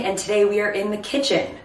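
normal talking voice

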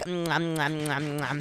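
soft contented mm while chewing; munching. Acted. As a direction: savour every bite. Eating cookies during race.

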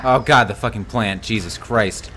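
ordinary talk

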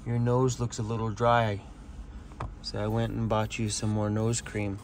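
low, hushed voice to the dog; soft pet talk. Real, not acted. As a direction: coo gently at your pet. Talking softly to his dog.

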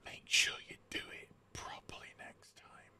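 breathy hushed threat; whispered warning. Retold or imitated. Karen warns the neighbor about his bin, whispered. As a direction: lean in and whisper it.

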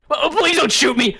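high, frightened begging; acted panicky angel; anxious pleading. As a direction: plead as if about to be hurt. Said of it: "the easily anxious angel".

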